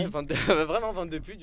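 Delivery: laughing while saying the name; amused laugh breaking in. Real, not acted. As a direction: giggle through the words.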